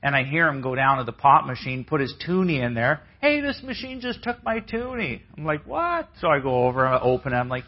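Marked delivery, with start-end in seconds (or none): 3.2-5.2 high-pitched complaint about the machine; old man whining at the vending guy; grumbling old man. Retold or imitated. Grandpa complaining about the pop machine. 5.7-6.1 sharp high startled question; startled by the complaint; surprised what. Retold or imitated.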